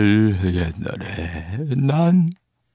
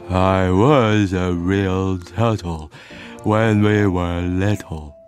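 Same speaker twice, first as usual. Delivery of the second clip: very slow, sorrowful turtle; mournful, long-drawn reminiscence; sighing; 3 semitones lower, much slower than usual. Acted. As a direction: drag each word out after a deep sigh. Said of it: "with a deep sigh".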